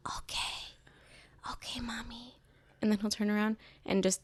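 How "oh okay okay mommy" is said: put-on soft toddler whisper; hushed, breath-only mock agreement; imitating obedient child. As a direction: whisper it like a little kid playing along. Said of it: "He'll be like".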